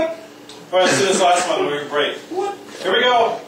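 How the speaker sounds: ordinary talk, nothing marked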